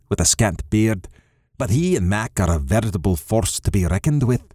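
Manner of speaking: plain reading voice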